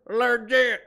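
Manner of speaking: "Legit!" belted high in full Appalachian twang, "luh-JEH"; Appalachian "Legit!" hollered; loud twangy legit. Shown as a demonstration. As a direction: shout "Legit!" with a heavy country twang. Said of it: "I got one hell of a twang".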